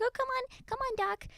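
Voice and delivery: high, eager sing-song call to the dog. Acted. Owner coaxing her new dog Doc in the park, high eager call. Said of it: "I'm gonna do a little acting".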